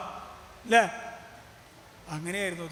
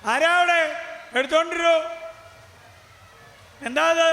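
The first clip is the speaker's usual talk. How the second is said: king shouting high and loud for his servants; king's call; 6 semitones higher, 5 dB louder than usual. Acted. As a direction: call out to the palace servants, louder and higher. (കൊട്ടാരത്തിലെ ഭൃത്യരെ രാജാവ് ഉറക്കെ ഉയർന്ന സ്വരത്തിൽ വിളിക്കുന്നു)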